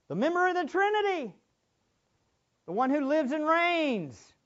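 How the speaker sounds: rally the church to action; full-force pulpit charge; preaching at full force. Acted. Exhorting the congregation.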